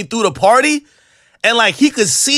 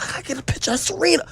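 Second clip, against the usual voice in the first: fast, breathless fan voice; quick excited groupie aside; starstruck fan gush; 6 semitones higher, 8 dB quieter, faster than usual. Retold or imitated. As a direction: gush like a groupie spotting a star. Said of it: "I'm like".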